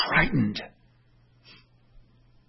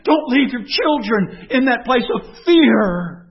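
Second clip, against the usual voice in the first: raised and loud, preaching hard to the congregation; fervent preacher's call; full-force preaching; 9 semitones higher, 6 dB louder than usual. Acted. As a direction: thunder it from the pulpit.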